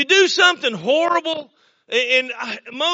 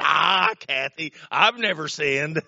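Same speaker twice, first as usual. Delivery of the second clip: imitated neighbor; 8 semitones lower than usual. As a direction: play the neighbor brushing off the question, pitched way down. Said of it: "He said".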